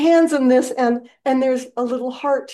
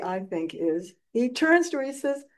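normal talking voice

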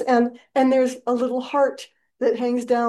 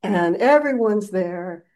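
ordinary talk

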